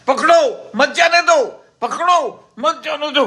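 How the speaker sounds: high-pitched calling out; mock yell of orders from far behind; acted shout. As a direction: shout it after runners, pitch well up. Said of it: "पीछे से जोर से चिल्लाए".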